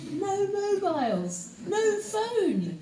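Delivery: high-pitched, incredulous wail of pretend horror; mock horror. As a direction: play up the disbelief for the kids. Mock horror at life without phones.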